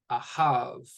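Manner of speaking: "ahav" with the stress on its last syllable, ha; a-HAV, punching the second syllable of "ahav"; final-syllable stress. Shown as a demonstration. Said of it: "The ha gets the stress".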